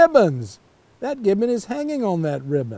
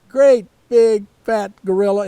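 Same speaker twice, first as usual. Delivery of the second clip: usual speaking voice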